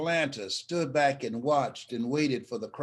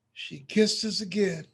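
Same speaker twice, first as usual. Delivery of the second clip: ordinary talk, nothing marked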